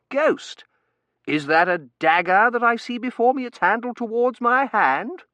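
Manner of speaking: high, loud, declamatory voice; theatrical young man. Acted. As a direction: recount it grandly, quoting Shakespeare. Terence's voice.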